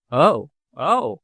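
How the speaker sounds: ordinary delivery, nothing marked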